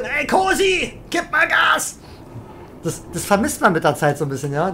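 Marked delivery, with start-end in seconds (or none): 0.0-2.0 high shouted mock nag; imitating Rip. Imitating teammate Rip yelling at Cosi to speed up, high mock shout. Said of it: "mit Rip mal diskutieren".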